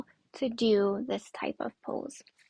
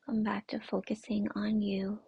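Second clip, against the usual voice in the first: guided meditation. Acted. Yoga teacher centering the class on their breath, guided meditation. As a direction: guide them gently inward, unhurried and soothing.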